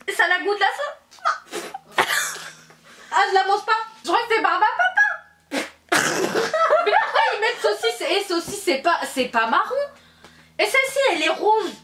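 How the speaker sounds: high laughing disgust at the taste. Real, not acted. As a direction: giggle through the awful taste. Eating a disgusting bean.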